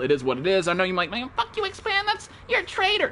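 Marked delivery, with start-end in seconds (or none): 1.1-3.1 high, quiet whiny mimicry of a viewer; mock viewer complaint. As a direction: squeak out a mock accusation, much higher and softer.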